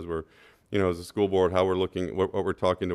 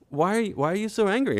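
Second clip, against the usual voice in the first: raised, pleading why; exasperated question; 13 semitones higher than usual. Retold or imitated. Cyclist asks the angry dog walker why, pitched up. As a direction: plead with her to calm down. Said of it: "again, I said".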